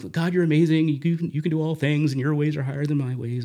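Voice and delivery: high, eager, forced praise hiding anger; dutiful praise prayer. Retold or imitated. Praying to God while secretly angry, high performed praise. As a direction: gush praise you don't feel.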